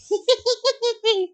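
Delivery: very high, rapid hee-hee cackle; hyena laugh. A narrator's reading. Kara laughing like a hyena over the prank, cackling. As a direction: cackle in high staccato bursts. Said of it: "Kara laughed like a hyena".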